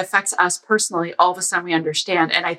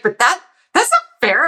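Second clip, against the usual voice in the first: indignant; 9 semitones higher, 4 dB louder than usual. Retold or imitated. Guy suddenly objecting.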